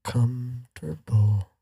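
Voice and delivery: "Comfortable" stretched slowly, syllable by syllable; drawn-out. Shown as a demonstration. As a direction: draw out "Comfortable" like you're about to sing. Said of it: "You gonna start singing?".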